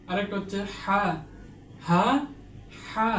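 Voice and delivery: breathy হা from the throat; modelled throaty হা; throat letter demo. Shown as a demonstration. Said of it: "কিভাবে উচ্চারণ করতে হয়". (গলা থেকে হা)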